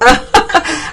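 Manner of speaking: bright burst of laughter at the guest's nerves; short high amused laugh. Real, not acted. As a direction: laugh it off warmly.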